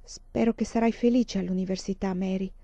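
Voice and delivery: plain reading voice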